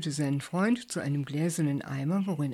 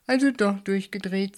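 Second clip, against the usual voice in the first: ordinary delivery, nothing marked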